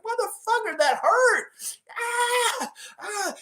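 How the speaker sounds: high, pained mascot voice with cries; hurt Raptor. Retold or imitated. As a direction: whine in pain after being shot. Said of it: "The Raptor's like".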